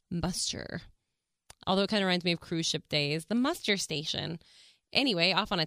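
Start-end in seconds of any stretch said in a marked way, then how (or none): 0.1-0.8 disgusted. Shown as a demonstration. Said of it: "It sounds so gross".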